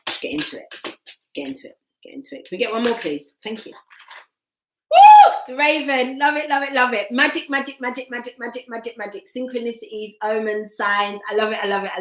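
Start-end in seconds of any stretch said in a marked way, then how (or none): 0.1-2.5 self-urging whisper. Real, not acted. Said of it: "I'm shutting myself up". 4.9-5.3 a high, loud joyful woo; excited whoop. Real, not acted. Reader cheers the Raven card with a high whoop. 5.5-9.2 higher, gushing with delight. Real, not acted. Reader thrilled the Raven card came out, gushing higher.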